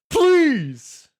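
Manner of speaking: loud, high cry of frustration; drawn-out frustrated yell; exasperated plea. Real, not acted. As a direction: beg a stalled video, at full voice. Begging the stalled video to play.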